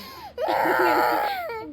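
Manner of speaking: high wailing cry. Real, not acted. Child crying over shirt. (malakas na iyak)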